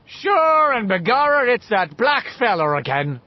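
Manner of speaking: put-on boy's loud stage-Irish cop. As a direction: ham up an Irish policeman. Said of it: "in his Irish cop voice".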